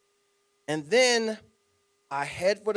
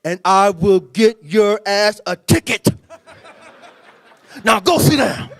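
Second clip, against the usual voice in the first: slow, loud, gruff scolding; a furious coach keeping it contained; stern coach; 4 semitones lower, 10 dB louder than usual. Retold or imitated. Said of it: "He's like".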